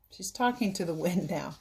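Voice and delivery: usual speaking voice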